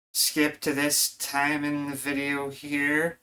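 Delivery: slow, mock YouTuber announcer. Aping YouTubers to his viewers, slowed mock presenter voice. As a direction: put on a YouTuber's slow pitch. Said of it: "what is it the YouTubers say?".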